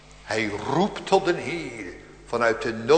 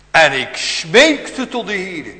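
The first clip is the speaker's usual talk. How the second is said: louder, slow psalm recital; fervent reading; 5 semitones higher, 10 dB louder, slower than usual. Acted. Preacher reciting the psalm to his flock, slow fervent. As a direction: dwell on smeekte.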